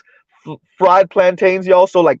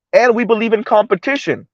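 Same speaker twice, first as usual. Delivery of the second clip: higher-pitched mocking quote of the elite; 7 semitones higher than usual. Elite claiming faith in competition, mockingly voiced. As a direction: parrot their claim in a raised, mocking pitch.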